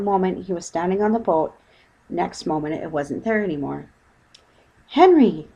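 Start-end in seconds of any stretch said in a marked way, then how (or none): 4.9-5.5 sudden high shout of alarm; loud alarmed cry. A narrator's reading. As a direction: call out to him, alarmed. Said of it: "shouted Missus Brown".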